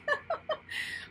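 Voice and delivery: high airy laugh of emotion; a soft, overwhelmed giggle; breathless giggle. Real, not acted. Reader laughing at the beauty of the reading.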